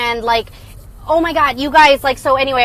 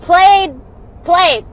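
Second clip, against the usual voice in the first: loud, high punchy call; loud mocking played; loud verdict; 6 semitones higher, 8 dB louder than usual. Real, not acted. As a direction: slam it down like a verdict. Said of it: "punchline repeated".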